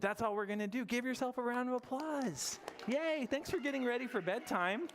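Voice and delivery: excited praise; cheerful. Real, not acted. Cheering the class.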